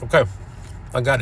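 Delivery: chewing while talking; speaking around food; mouth partly full. Real, not acted. Seen 1: Bites bun at -3, mouth still working, bitten bun held while speaking.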